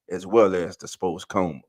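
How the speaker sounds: ordinary talk, nothing marked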